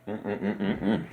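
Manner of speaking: soft mm-mm of delight over a donut; savoring food hums. Real, not acted. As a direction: hum with pleasure while chewing.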